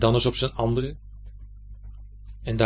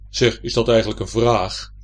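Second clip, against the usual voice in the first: loud, big-voiced questioning; heavy, forceful character voice; 2 semitones lower, 7 dB louder than usual. A narrator's reading. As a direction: boom the question in a weighty voice. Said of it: "zegt de stem".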